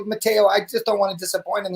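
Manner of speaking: ordinary talk, nothing marked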